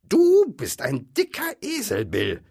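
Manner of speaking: high, loud insult; quarrelling troll. A narrator's reading. Bert insulting Bill mid-quarrel, angry squawk.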